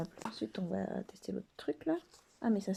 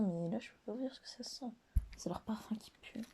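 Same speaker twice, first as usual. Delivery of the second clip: whisper; 2 semitones higher, 4 dB quieter than usual. Real, not acted. Whispering about the smell.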